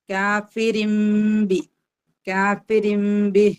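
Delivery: slow recitation of കാഫിരിംബി, nun turned to m; recited with iqlab. Shown as a demonstration. Teacher showing the nun turned to meem, slow recitation. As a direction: chant കാഫിരിംബി slowly with a clear m before b. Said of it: "തൻവീനിന് ശേഷം ബി വന്നാൽ".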